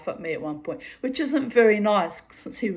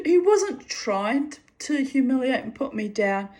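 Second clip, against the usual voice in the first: ordinary talk, nothing marked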